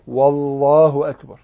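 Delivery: والله said slowly with a clear extra و; added waw before Allah. Shown as a demonstration. Teacher demonstrating the forbidden waw, slow and clear. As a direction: start with a waw: والله أكبر. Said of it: "لا يزيد واء قبل لفظ الجلالة".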